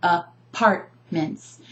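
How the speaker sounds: "apartments" slowly in chunks, a-PART-ments; "apartments" split by syllable, middle one strongest; stress on second syllable. Shown as a demonstration. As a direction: tap out "apartments", leaning on PART. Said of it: "I'm emphasizing the second syllable".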